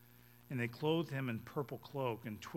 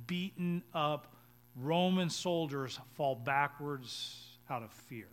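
usual speaking voice

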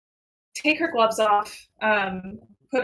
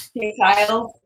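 ordinary talk

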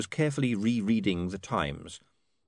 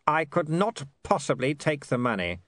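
lightly voiced proud father, higher-pitched; proud lord; 7 semitones higher than usual. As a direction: refuse with stiff dignity.

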